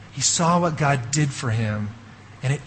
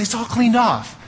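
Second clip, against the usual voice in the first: mock cheerful; 8 semitones higher than usual. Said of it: "And I say".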